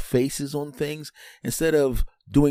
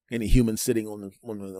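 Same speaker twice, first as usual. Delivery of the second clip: ordinary talk, nothing marked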